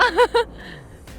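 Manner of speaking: high, bubbling laugh; real laughter. Real, not acted. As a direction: burst into giggles. Laughs after phrase. (высокий заливистый смех)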